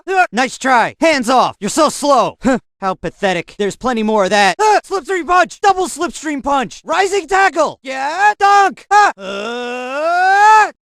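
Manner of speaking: loud, high fighting-game attack shouts; battle cries. Acted. Fighter in combat yelling move names, loud battle cries. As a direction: bark each move name as you strike.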